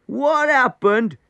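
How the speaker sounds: high, slow, loud echo of the question; stretched-out, astonished holler; incredulous shout. A narrator's reading. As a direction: throw the question back, drawn out in disbelief. Turban man echoes question incredulously.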